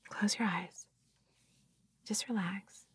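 hushed soothing whisper. Acted. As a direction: lull them with a whisper. Whispered lulling commands.

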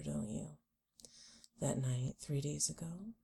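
usual speaking voice

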